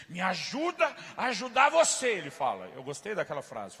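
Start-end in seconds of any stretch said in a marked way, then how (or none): 0.1-2.1 slow, higher, louder plea; agent pleading. Retold or imitated. Sports agent urging athlete, slow pleading. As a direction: urge him to let you help. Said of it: "ele diz".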